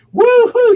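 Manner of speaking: high, loud "woo-hoo" whoop; imitated screaming women. Model imitates women in the audience, screaming whoop. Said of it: "these women that were screaming".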